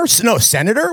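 ordinary talk

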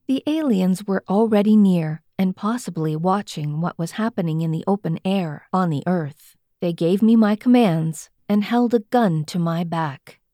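plain reading voice

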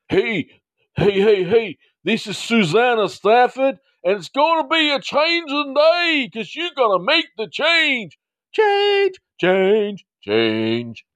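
loud, very high-pitched crowd-rousing yell. Acted. Host acting Susanna announcing herself to a crowd, yelling out. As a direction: holler it high, fading on the last repeats. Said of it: "you could yell out there".

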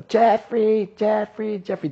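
high shrill calling of the name; mock yelling wife. Husband imitating his wife calling him, high mock shout. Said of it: "ella grita mucho".

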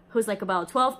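normal talking voice

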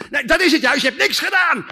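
loud, high snap; angry Martha. Acted. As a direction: spit the accusation at her sister.

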